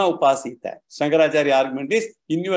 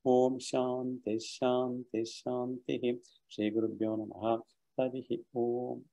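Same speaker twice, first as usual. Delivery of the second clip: soft, low recited prayer to close class; quiet Sanskrit peace invocation; closing prayer; 5 semitones lower, 6 dB quieter than usual. Acted. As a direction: intone the peace chant gently.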